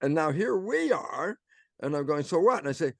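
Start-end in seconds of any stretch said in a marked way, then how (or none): 2.2-2.6 higher, louder "so what"; retold dismissive retort; dismissive challenge. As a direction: toss it back as a challenge.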